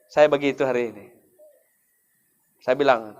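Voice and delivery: usual speaking voice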